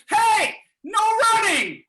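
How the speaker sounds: loud, high, drawn-out yell; lifeguard's shout. Acted. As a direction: bellow it like a lifeguard at the pool. Lifeguards yell after fleeing patrons.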